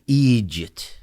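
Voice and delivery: Egypt as "EE-jit", the final t softened into a hush; Irish hushed ending. Shown as a demonstration. Comedian demonstrating the hush sound at the end, Irish pronunciation. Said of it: "little kind of hush sound at the end".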